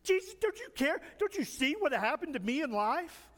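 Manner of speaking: high-pitched whining to Jesus; hammed-up, needy high whine. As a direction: pile up complaints in a whiny plea. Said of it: "start whining to Jesus".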